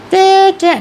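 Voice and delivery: very high loud cek cek like ghostly music; mimicked eerie click. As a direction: click out the creepy graveyard music. (bunyi muzik seram diajuk nyaring)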